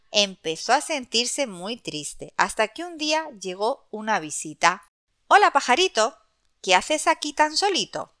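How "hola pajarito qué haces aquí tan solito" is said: high, friendly bird voice; friendly heron voice. Acted. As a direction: greet him kindly, pitched up.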